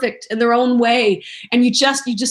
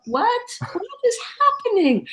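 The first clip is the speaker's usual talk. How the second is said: high, bewildered questions, voice rising; mock confusion at crying fans; 12 semitones higher, 3 dB quieter than usual. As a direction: voice actor imitating her confusion as fans cry. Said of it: "And you're like".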